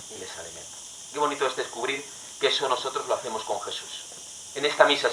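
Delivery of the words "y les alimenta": "alimenta" dropped to a near-whisper; soft repeat. Shown as a demonstration. Preacher repeats the line softly to the congregation. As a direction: echo it very softly, letting it sink in.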